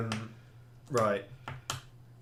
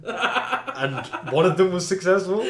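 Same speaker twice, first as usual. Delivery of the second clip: laughing; 6 semitones higher, 6 dB louder, slower than usual. Real, not acted.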